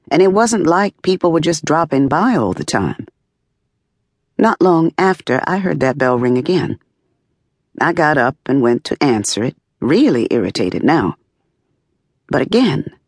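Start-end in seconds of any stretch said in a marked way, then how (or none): none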